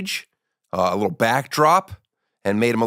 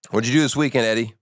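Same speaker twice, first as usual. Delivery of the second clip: ordinary talk, nothing marked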